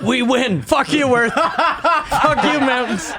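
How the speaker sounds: mocking the planet, high gloating taunt; mock triumphant. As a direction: crow in victory, cackling.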